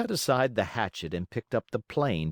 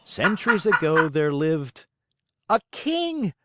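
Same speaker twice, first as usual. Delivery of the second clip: eager guess; 5 semitones higher, 4 dB louder than usual. Acted. Imagined readers' eager guess.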